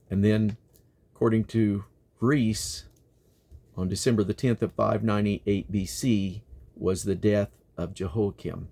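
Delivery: ordinary delivery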